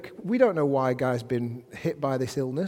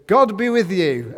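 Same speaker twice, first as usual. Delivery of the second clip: loud, higher, proclaiming it like a boss entering; boss's loud blessing; 5 semitones higher, 9 dB louder than usual. Pretend. Boss greets the staff, loud proclaiming blessing. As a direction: call the blessing out across the office. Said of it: "your boss walks in and goes".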